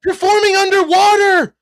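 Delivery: loud and high, overexcited; yelling in real excitement over the video; worked-up yelling. Real, not acted. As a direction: blurt it out, all fired up. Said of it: "I'm sorry, I'm yelling".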